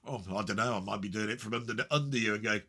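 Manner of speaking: usual speaking voice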